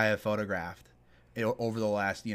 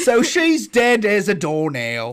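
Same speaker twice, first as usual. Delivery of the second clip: slow loud Cockney-ish British voice; British accent; 8 semitones higher, 12 dB louder, slower than usual. Pretend. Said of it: "doing British accents".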